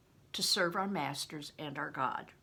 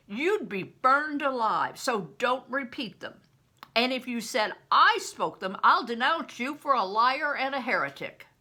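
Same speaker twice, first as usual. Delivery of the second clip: louder, stern threat; angry warning; 5 semitones higher, 8 dB louder than usual. Acted. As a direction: threaten him with burning. Bear threatens Crispin.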